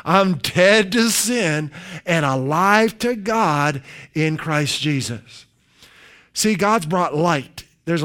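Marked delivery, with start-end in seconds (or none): 0.0-5.5 slow, measured words of faith; slow deliberate recitation. Shown as a demonstration. Preacher leading one more slow repetition of the confession. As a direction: spell it out slowly for the flock. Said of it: "One more time".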